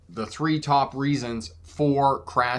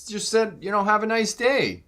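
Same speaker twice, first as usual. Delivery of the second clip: higher, innocent-sounding sweetness; mock innocence; 7 semitones higher than usual. Truck driver to the angry driver, feigned innocence. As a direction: play dumb, all innocent niceness. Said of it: "I'm like".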